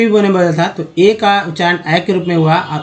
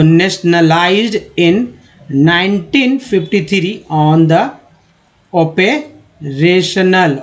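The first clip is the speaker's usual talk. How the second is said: slow reading aloud; much slower than usual. Shown as a demonstration. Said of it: "teacher reads sentence slowly".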